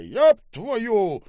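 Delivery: loud, high outburst; furious curse. Acted. Boss bangs the table at the waiter, furious curse. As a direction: explode with the oath.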